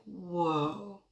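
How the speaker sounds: soft, retold stunned whoa; stunned reaction. Mom hearing daughter's accusation, stunned reaction. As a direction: let it out quietly, taken aback. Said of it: "I'm sitting there like".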